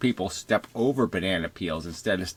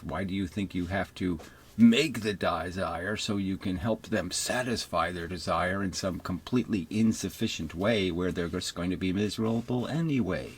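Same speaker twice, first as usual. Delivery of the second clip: normal talking voice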